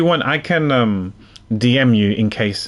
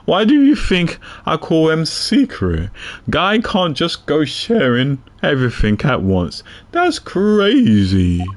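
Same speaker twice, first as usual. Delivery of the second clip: detective character voice; 5 semitones higher, slower than usual. Acted. Reads detective character lines.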